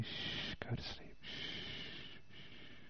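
put-on soft hushing whisper to a child; whispering over a crib. Shown as a demonstration. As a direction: hush a little child to sleep. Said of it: "having a little child. Say".

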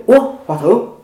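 high, loud startled "what?" on finding him; retold jolt of alarm; startled cry. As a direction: gasp out the surprise at the fallen elder. Monk finding fallen abbot.

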